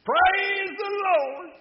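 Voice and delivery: very high, drawn-out joyful holler; elderly church lady's high-pitched holler; exultant shout. Acted. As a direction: shout it to the heavens, stretching every word. The lady's daily porch shout.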